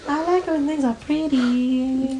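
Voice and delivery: wispy granny voice; old lady voice. Acted. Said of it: "This is [name]'s new podcast voice".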